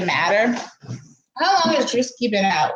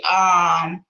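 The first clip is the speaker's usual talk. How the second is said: usual speaking voice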